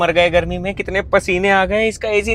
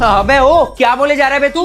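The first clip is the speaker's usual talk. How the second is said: sharp, loud and higher angry comeback; angry retort; 6 semitones higher, 6 dB louder than usual. Acted. Boy yells back at his mocking brother, angry. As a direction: snap back hard at the teasing. Brother mocks him as dog.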